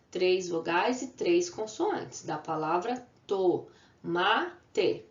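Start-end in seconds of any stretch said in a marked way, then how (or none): 3.3-5.0 "tomate" split into to, ma, te; measured three-beat "tomate" for a class; syllable by syllable. Shown as a demonstration. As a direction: pause after each syllable of "tomate". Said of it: "na separação de sílabas".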